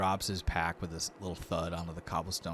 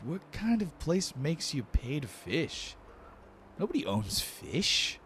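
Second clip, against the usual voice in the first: slow, lightly raised complaining voice; baffled Lortho; 8 semitones higher, slower than usual. Acted. Lortho watches the guard go, mildly baffled slow complaint. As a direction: drag it out in mild disbelief.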